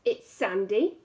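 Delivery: usual speaking voice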